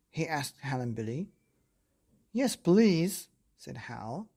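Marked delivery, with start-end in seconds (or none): 2.3-3.3 higher, louder keen acceptance; eager child. Acted. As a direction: jump at the offer like a delighted child. Hal answers dinosaur eagerly.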